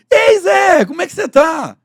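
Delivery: put-on high, booming greeting to an old pal; loud, chummy call across the room; overfamiliar hail. Shown as a demonstration. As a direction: shout it like you already know him. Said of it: "fala como se você já o conhecesse".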